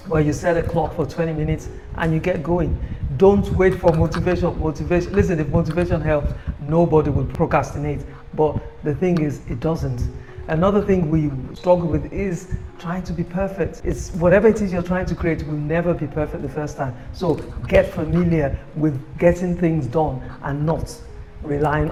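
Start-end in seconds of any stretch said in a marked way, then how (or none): none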